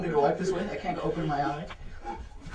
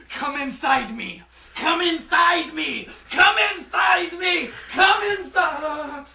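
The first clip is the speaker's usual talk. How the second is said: building from plain to loud shouts; pleading shout; 7 semitones higher, 6 dB louder than usual. Acted. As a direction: beg louder and louder, slowing. Priest begs the demon to enter.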